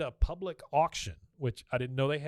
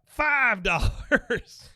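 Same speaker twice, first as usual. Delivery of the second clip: loud, slow, high triumphant bid; 10 semitones higher, 9 dB louder, much slower than usual. Retold or imitated. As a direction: call it out like a winning move. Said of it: "Watch me take this guy out".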